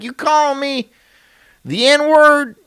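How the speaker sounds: southern inflection. Pretend. Pretending to knock a plate away, offended, in a Southern accent. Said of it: "have that southern inflection with it".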